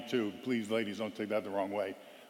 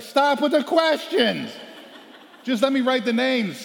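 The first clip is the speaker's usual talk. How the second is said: much higher, louder, slowed, laughing shout; exasperated shout; 11 semitones higher, 8 dB louder, slower than usual. Retold or imitated. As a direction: cut them off with a laughing yell. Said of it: "It's like".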